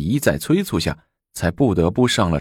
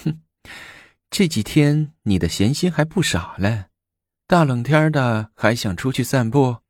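higher, mocking cold sneer; 6 semitones higher than usual. Empress mocking the emperor's evening stroll, sneering. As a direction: needle him with a scornful laugh in the voice. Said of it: "武则天冷笑说".

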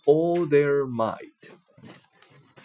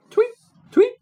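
high, loud chirped tweet tweet; bird tweet; 6 dB louder than usual. A narrator's reading. Narrator chirping as the bird flies past, high and loud.

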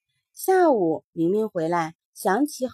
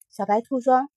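ordinary delivery, nothing marked

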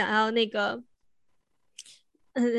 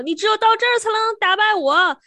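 shouted taunt, much higher and louder; monster's taunting shout; 10 semitones higher, 6 dB louder than usual. Retold or imitated. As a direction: yell it up at the prince from below like a gloating beast. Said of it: "大声地...冲王子喊说". (怪兽冲王子大声叫喊)

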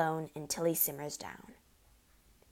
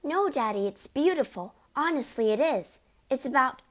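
put-on higher, earnest child's protest; pleading girl; 6 semitones higher, 3 dB louder than usual. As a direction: beg him to understand. Matilda pleads with father.

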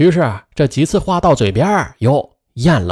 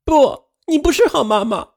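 put-on high, hurt child shouting; yelling; 11 semitones higher than usual. A narrator's reading. Daughter yelling at her mother, high hurt child. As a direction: hurl the accusations at mom. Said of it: "雪莉突然大叫".

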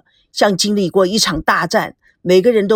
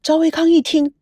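usual speaking voice

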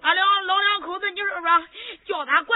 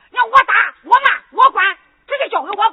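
louder, emphatic declaration; forceful vow; 7 dB louder than usual. Acted. Father promising to punish the child himself, forcefully. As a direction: lay down the law. Father vows to discipline loudly.

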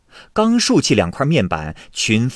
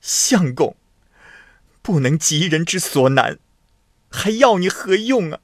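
wailing reproach; 7 semitones higher than usual. A narrator's reading. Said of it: "公主嚎啕大哭地说".

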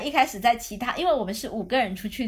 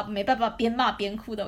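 ordinary talk

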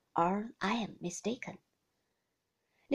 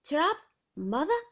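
raised, louder encouraging call; cheering cry; 7 semitones higher, 7 dB louder than usual. Acted. Mr Peggotty loudly cheers up Missus Gummidge. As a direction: buck her up loudly. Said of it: "cried Mister Peggotty".

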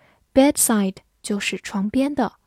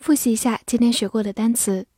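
ordinary talk, nothing marked

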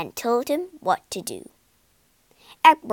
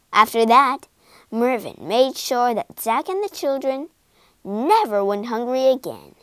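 ordinary delivery

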